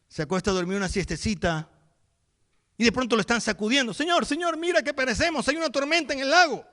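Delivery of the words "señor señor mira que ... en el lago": much higher, frantic cry for help; panicked plea. Acted. Disciples waking Jesus in the storm, high panicked cry. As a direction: shake him awake in terror.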